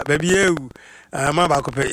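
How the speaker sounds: normal talking voice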